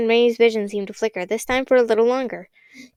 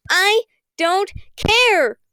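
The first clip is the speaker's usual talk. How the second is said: much higher, slow, drawn-out yell; deafening shout; 10 semitones higher, 4 dB louder, much slower than usual. Acted. Constance screams defiance at Kern, high, slow and very loud. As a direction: scream it with every ounce of defiance. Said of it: "in a voice so loud it hurt everyone's ears".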